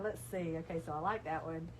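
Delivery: usual speaking voice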